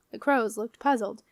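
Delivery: ordinary delivery, nothing marked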